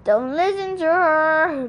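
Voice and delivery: slow, lightly voiced snake; drawn-out objection; George. A narrator's reading. Male snake objects to his partner, slow and lightly voiced.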